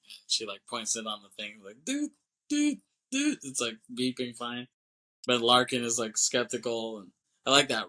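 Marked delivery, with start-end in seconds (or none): 1.8-3.4 much higher, squeaked dude dude dude; squeaky dude dude. Retold or imitated. Ground control gadget beeping, squeaked high dude dude dude.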